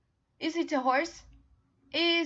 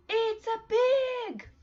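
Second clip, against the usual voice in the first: much higher, louder and slow; model to repeat; 10 semitones higher, 6 dB louder, slower than usual. Shown as a demonstration. Teacher modelling the answer for the class to repeat. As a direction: sing it out clearly for kids to copy.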